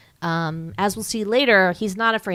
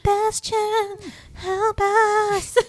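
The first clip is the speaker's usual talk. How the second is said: much higher, hammed-up pleading voices; mock ghostly children; 14 semitones higher, much slower than usual. Children trapped in the book beg Bastian for help, ghostly and high. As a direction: call out like lost children inside a book.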